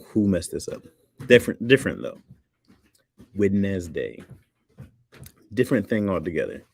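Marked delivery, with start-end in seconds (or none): 3.3-4.2 "Wednesday" said as WED-nez-day; spelling pronunciation. Shown as a demonstration. Streamer showing the D in the middle, "Wednesday" as WED-nez-day. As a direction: sound out the silent D in "Wednesday". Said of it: "there's an A D in the middle of Wednesday".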